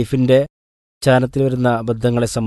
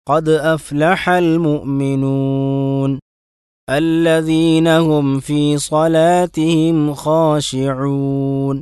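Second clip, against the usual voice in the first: slow, drawn-out tajweed chant; slow Quran recitation; 2 semitones higher, much slower than usual. Shown as a demonstration. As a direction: recite carefully, stretching each vowel.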